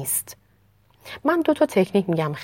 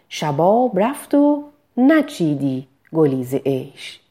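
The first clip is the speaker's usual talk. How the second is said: slow, measured recital of verse; poetry recitation; 4 dB louder, slower than usual. Shown as a demonstration. Diction teacher reciting Hafez slowly to students. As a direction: recite the line slowly, weighing each word.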